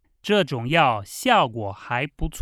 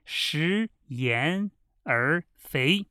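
over enunciating; 3 dB quieter, much slower than usual. Shown as a demonstration. Teacher repeats the proverb for drill.

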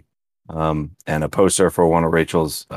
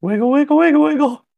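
very high cartoonish little chant; playful squeaky voice. Co-host giving the host his start signal, playful squeak. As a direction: chirp it in a tiny high-pitched voice.

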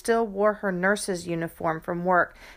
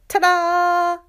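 high, loud triumphant ta-da; shouted ta-da; 11 semitones higher, 8 dB louder than usual. Acted. Child showing mom her cleanup, triumphant shout. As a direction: announce it like a magic trick. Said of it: "I wiped my hands and shouted".

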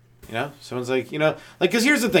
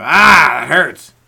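mock pain; 11 dB louder, much slower than usual. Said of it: "You go".

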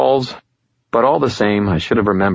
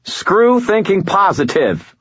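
high, slow, exasperated half shout; 13 semitones higher, 4 dB louder, slower than usual. A narrator's reading. Annoyed man repeating himself to a caller, half shout. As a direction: raise the pitch and punch it out slowly. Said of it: "I half shouted".

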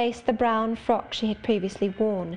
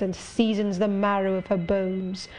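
plain reading voice

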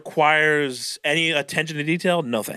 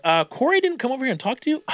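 mock surprise; 9 semitones higher than usual. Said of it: "throw a name that doesn't even exist".

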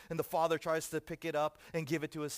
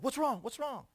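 high-pitched, startled dad; alarmed father; 12 semitones higher, 7 dB louder than usual. Acted. Father to screeching toddler, alarmed. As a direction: blurt it high, flustered by the screaming.

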